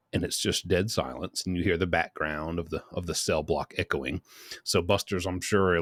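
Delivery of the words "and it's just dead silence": ordinary delivery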